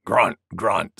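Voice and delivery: low boar-like grunts; grunting wild boar. A narrator's reading. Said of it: "said Wild Boar".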